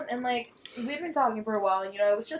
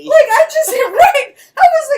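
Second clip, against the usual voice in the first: much higher, much louder laughing yell; laughing shout; 16 dB louder, slower than usual. Real, not acted. Telling friends the story, laughing shout.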